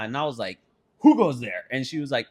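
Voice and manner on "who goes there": louder hammed-up who goes there; playful medieval challenge; mock sentry call. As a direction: call out like a castle guard. Mock guard call from balcony.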